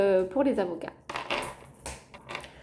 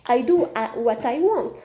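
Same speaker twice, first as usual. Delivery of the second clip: French speaker showing off an English accent; English phrase in a proudly attempted accent; 4 dB louder than usual. Shown as a demonstration. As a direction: playfully put on your best English accent. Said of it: "Vous avez vu l'accent anglais".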